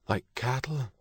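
soft, high-pitched private aside; appalled inner thought. A narrator's reading. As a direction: think it to yourself in dismay. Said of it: "Kate thought".

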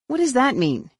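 high-pitched exasperated question. A narrator's reading. Jason questioning a cryptic answer, exasperated. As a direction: throw the question out in frustration. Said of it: "said in obvious exasperation".

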